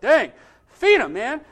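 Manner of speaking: high, loud, drawn-out protest; exclaimed mock outrage. Visitor at a sad zoo pleading for the animal, mock outrage. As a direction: blurt it out in comic dismay.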